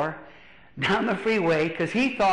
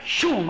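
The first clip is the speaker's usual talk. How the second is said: higher-pitched vocal zoom of a passing truck; imitated whoosh; 4 semitones higher than usual. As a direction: mimic a truck shooting through. Imitates truck running red light.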